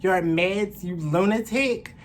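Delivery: loud, fast, high scolding; furious telling-off. Retold or imitated. Woman yelling at the off-his-meds man, furious. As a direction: yell at him with contempt. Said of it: "I'm like".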